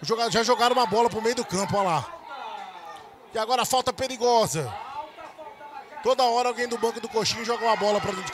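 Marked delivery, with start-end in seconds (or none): none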